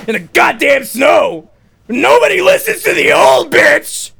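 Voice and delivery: high, slow comic fury; hammed-up screaming rant; ranting shout. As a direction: yell it out in exasperation. Granny rant builds into furious shouting.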